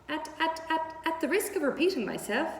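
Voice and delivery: a goose that can't stop repeating herself; stammering goose. Acted. Goose answering Wilbur, high stammering goose voice. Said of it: "who talked rather fast".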